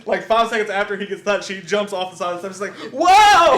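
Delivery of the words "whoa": high, loud, delayed cry; hammed-up startled holler; mock falling yell. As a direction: yell like a bad stuntman falling. Imitating delayed fall off steps.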